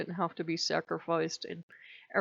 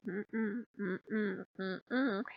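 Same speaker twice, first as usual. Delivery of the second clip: soft, hummed little cat refusal; cat saying no; 7 dB quieter, much slower than usual. Retold or imitated. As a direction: hum a prim no like a cat. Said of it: "the cat's like".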